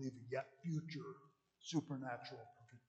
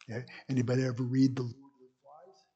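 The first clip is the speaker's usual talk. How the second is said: normal talking voice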